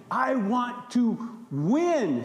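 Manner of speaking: slow, drawn-out greedy want; voice of selfish ambition. Acted. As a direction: speak as a selfish ambition that wants to win. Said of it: "selfish ambition says".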